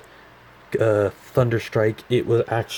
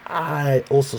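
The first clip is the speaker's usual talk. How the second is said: squeaky voice; 5 semitones higher, 3 dB louder, slower than usual. Real, not acted. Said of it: "sorry for my squeaky voice".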